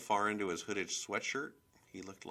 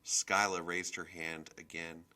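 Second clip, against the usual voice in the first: ordinary delivery, nothing marked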